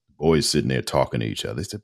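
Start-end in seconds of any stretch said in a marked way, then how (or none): none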